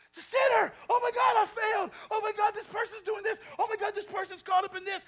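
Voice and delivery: loud, high shouted mock alarm; hammed-up alarmed shouting at sin; mock panic. Mocking churchgoers' panic over sin.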